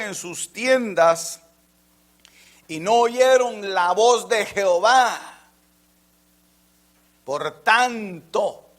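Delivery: slow, loud solemn reading; loud, slow, ending in a whisper; scripture reading. Acted. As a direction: proclaim the psalm slowly. Reads scripture aloud.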